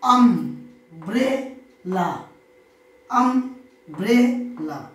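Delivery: "Umbrella" very slowly as আম-ব্রে-লা; three separated beats in "umbrella", pausing after each; split into three syllables. Shown as a demonstration. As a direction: sound out "umbrella" one syllable at a time. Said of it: "এরা হচ্ছে ট্রাইসিলেবল।".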